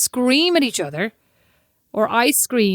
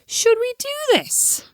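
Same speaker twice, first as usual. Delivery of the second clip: "Should we do this?" drawn out slowly at a much higher pitch; emphatic pronunciation; 13 semitones higher, slower than usual. Shown as a demonstration. Trying out how a question sounds, emphatic pronunciation. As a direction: stretch "Should we do this?" high and emphatic. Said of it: "we have very emphatic pronunciation".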